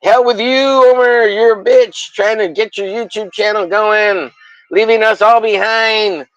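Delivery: high, mock-angry put-on voice of the old crew; mock friends' voice. Friends brushing off the streamer, in a high mocking voice. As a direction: sneer it like a spurned friend. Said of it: "They're like".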